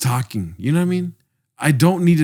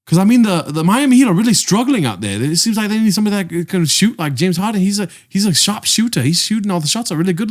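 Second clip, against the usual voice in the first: higher-pitched, rambling mock Australian; Australian accent; 6 semitones higher than usual. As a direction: keep chattering in a bad Australian accent. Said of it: "I don't know how to do an Australian accent".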